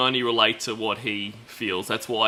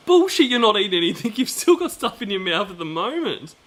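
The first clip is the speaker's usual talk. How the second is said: excited accusation; 9 semitones higher, 5 dB louder than usual. Real, not acted. Accusing him of eating.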